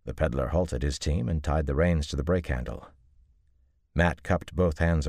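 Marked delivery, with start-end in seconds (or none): none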